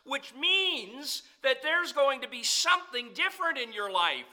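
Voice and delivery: loud, high, driving sermon delivery; full-force preaching. Acted. Preacher to his congregation, in full-force preaching.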